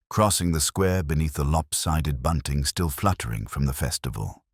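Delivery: ordinary delivery, nothing marked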